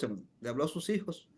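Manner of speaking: ordinary talk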